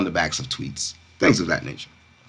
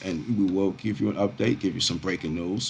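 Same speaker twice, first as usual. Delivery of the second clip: ordinary delivery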